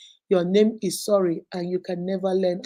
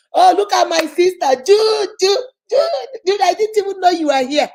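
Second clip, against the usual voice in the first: higher and louder, delighted; light up at seeing her; excited greeting; 9 semitones higher, 8 dB louder than usual. Real, not acted. Spotting her sister Juju in the live chat, excited, higher and louder.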